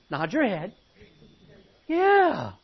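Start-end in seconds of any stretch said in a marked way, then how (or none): 1.9-2.5 high loud yeah. Real, not acted. Pastor's exclaimed agreement.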